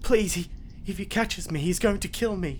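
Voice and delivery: high, urgent begging; trapped woman pleading for her life; frightened pleading. Acted. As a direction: persuade him out of fear. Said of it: "make a persuasion check, saying".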